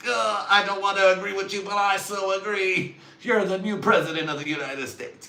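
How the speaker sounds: high, loud mock anguish; hammed-up agreement. Hating to agree with the speaker, hammed-up loud wail. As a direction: wail the agreement at full volume.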